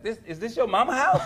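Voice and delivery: high, laughing comic disbelief; incredulous question. Retold or imitated. Comedian asks podcaster about the house, high mock incredulity.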